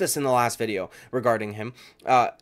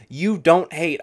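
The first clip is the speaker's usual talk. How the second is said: usual speaking voice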